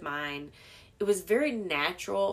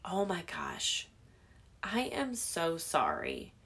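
apologetic; 6 dB quieter, slower than usual. Retold or imitated. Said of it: "and say".

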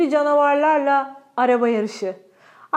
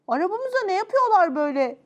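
high, mildly alarmed question; storybook alarm, moderate volume; fearful cry; 6 semitones higher than usual. Acted. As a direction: cry out with a touch of fear. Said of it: "diye bağırdım korkuyla".

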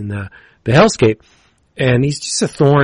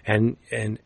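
ordinary delivery

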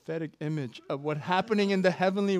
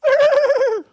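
very high, loud mock squeal; gleeful whinny; 14 dB louder than usual. God unworried by his enemies, high gleeful squeal. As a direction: let out a piercing joyful trill. Acting God's joy in heaven.

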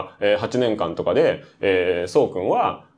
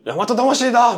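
hearty cheer; 10 semitones higher, slower than usual. Retold or imitated. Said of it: "OB cheering".

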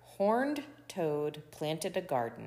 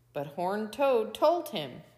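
plain reading voice

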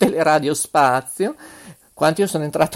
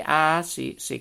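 normal talking voice

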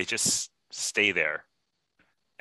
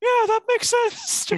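put-on squeaky high, slow falsetto girl; mock a naive girlfriend in a squeaky high voice; hammed-up falsetto Beth Ann; 6 dB louder, slower than usual. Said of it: "and she's like".

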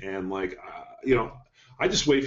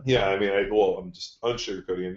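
usual speaking voice

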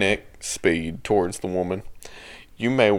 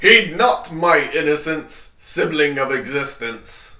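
slow, higher mock knightly cry; armored knight; 7 semitones higher than usual. Reader shouting at the truck in armor, mock knight. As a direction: declaim it slowly, pitched up, like a knight. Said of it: "like, a full suit of armor".

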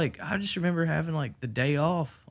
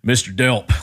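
stern, louder formal address; serious; 8 dB louder, slower than usual. Retold or imitated. Boss getting serious on the voicemail, stern and louder. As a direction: say his last name like a warning. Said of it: "she got serious".

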